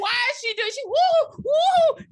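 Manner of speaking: very high loud mock wooing. Imitating the woos again, high loud mock. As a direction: squeal the woos in disbelief. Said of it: "I was like".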